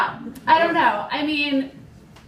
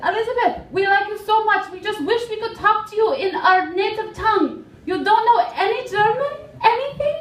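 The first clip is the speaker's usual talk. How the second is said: German customers; 9 semitones higher than usual. Retold or imitated. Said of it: "and they said".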